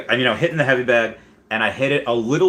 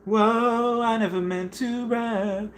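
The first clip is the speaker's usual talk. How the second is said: high, soft put-on voice; mock surprised rapper; 12 semitones higher, 3 dB quieter, much slower than usual. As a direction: gasp it out like a shy rapper caught rapping. Voicing a chat message.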